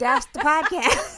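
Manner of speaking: slow, laughing delivery; can't hold back the laugh. Real, not acted. Laughing at the absurd explanation.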